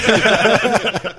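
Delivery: big burst of loud laughter. Real, not acted. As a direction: crack up hard. Laughing at a kiss joke.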